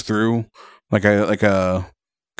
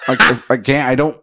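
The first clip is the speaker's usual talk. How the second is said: ordinary talk, nothing marked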